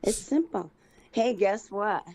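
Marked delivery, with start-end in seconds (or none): none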